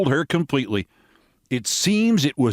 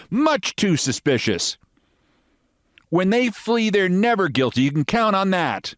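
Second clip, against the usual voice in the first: American lady voice; 9 semitones higher than usual. Acted.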